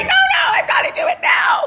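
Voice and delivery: very high mock shout; acted-out desperate high-pitched yell; frantic insistence. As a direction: squeal it out, hammed-up panic. Frantic insistence in a retold scene.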